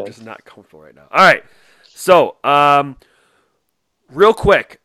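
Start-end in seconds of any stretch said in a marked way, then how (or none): 0.0-1.1 fast, much softer and lower; mock murmur. Repeating Peter's line to cohost, muttered. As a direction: rush it under your breath.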